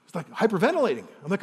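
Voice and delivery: ordinary delivery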